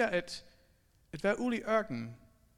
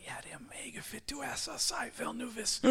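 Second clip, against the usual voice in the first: whispered, sly flattery; Satan whispering flattery to the proud one; 3 semitones higher, 6 dB quieter than usual. Acted. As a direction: whisper praise like a tempter.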